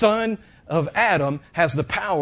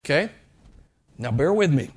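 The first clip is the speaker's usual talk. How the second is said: normal talking voice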